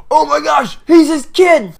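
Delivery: loud, high-pitched astonishment; mock shocked realization. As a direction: blurt it out like a stunned audience. Acts audience reacting to reveal.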